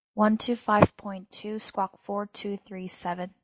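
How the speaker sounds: usual speaking voice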